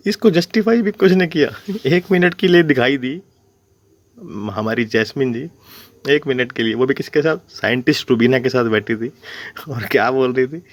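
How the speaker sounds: normal talking voice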